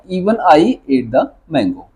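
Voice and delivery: धीरे स्पष्ट; slow model sentence. Shown as a demonstration. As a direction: read out slowly for class. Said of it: "तो मैं इसे कैसे बना सकता हूँ?". (धीमा उच्चारण)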